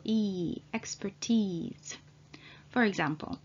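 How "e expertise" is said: a long E, then expertise ending in long EE; long vowel E. Shown as a demonstration. Teacher demonstrates expertise for learners, long final vowel. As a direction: hold the final EE of expertise, slowly. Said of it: "ease is pronounced as a long vowel".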